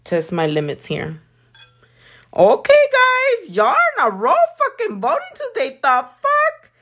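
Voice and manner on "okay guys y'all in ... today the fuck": much higher, laughing, delighted shriek; thrilled shrieking surprise; excited squeal. Real, not acted. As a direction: squeal at the flood of votes. Sudden burst of poll votes.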